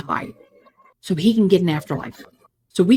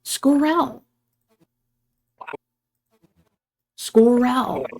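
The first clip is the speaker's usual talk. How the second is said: calling out; 5 semitones higher, much slower than usual. Acted. Calling out to missing friend.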